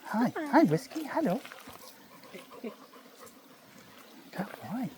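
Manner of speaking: high sing-song dog talk. Real, not acted. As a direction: coo at the dog, high and soft. Talking to the dog Whiskey.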